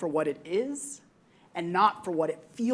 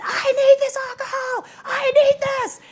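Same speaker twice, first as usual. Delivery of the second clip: high desperate shout; retold screaming; 8 dB louder than usual. A brother demanding the rubbing alcohol, imitated in a high pleading scream. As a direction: beg at the top of your lungs. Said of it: "kept crying and crying and screaming".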